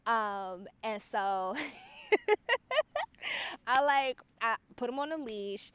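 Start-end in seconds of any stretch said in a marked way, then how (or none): 1.6-3.6 high loud laugh; burst of laughter. Real, not acted. Telling pet play story, high laughing burst. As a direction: crack up high.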